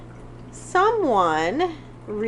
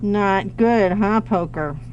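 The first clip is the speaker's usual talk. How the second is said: scolding the cat; 3 semitones higher than usual. Real, not acted. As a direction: tell the cat off sharply. Scolding the cat scratching recliners.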